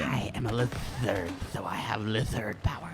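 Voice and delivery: whispery hissing lizard voice; Trandoshan hissing voice. Acted. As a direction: hiss it like a snake alien. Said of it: "I hated that voice they gave".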